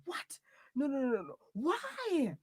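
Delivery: high, loud wail of disbelief; distraught protest. Acted. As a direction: cry out in theatrical despair.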